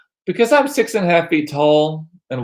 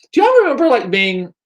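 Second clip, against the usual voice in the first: excited call-out; 10 semitones higher, 5 dB louder than usual. Real, not acted. Calling out to viewers.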